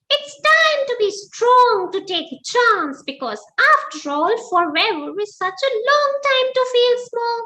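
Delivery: high, eager squeaky mouse voice; bursting with a bright idea; excited mouse. Acted. Mouse jumping out of bed, excited squeak. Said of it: "He said".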